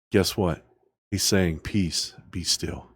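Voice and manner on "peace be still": much softer, lower "Peace, be still"; soft Jesus voice. Acted. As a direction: hush it like a gentle reassurance. Softly voicing Jesus.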